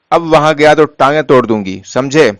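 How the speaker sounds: usual speaking voice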